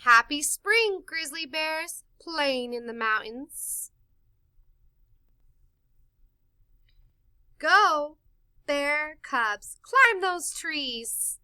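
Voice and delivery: put-on bright, lively read-aloud for kids; storybook reading. A narrator's reading. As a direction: read it like storytime to little ones. Reads picture book aloud.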